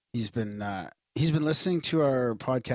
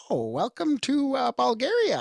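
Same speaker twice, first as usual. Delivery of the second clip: high, slow, showy host greeting; game show host; 12 semitones higher, 3 dB louder, slower than usual. Retold or imitated. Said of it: "he sounded like a game show host".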